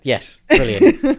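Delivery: high, slow and loud mock delight; overjoyed, dragged-out fake praise.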